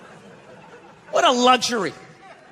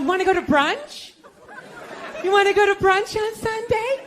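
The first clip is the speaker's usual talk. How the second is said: put-on eager girlfriend; 7 semitones higher than usual. Girlfriend voiced asking.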